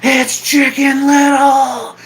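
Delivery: put-on loud, high, drawn-out drunken holler; drunk guy yelling. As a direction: yell it slowly at the top of your lungs like a drunk. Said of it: "some drunk guy started yelling".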